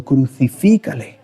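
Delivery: normal talking voice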